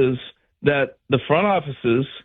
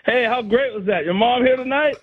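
higher, cheerful boast; imitating Reggie Jackson; 8 semitones higher than usual. Reggie bragging from second base, cheerful. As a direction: brag happily at the rookie. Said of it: "he's standing there going".

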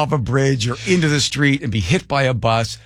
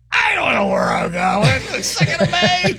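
very high, strained comic yell; mock exasperated shout; 12 semitones higher than usual. Reacting to a question about May plans, exasperated yell. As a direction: explode in absurd, squealing disbelief.

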